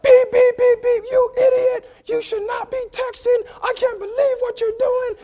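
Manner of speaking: very high, monotone horn-like shouting; mock an outraged driver on one high note; irate honking driver. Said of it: "this guy drives up".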